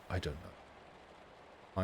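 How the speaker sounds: slow, breathless whispered shrug; lost in thought, whispering to himself. Real, not acted. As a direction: let it fade to a slow whisper. Trailing off, distracted.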